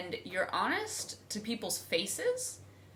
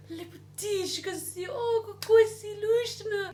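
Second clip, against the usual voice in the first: high doting voice, louder at the end; sweet old lady; 13 semitones higher, 6 dB louder than usual. Retold or imitated. Wife voicing the old Slovene lady cooing at her. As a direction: gush like a Slovene granny.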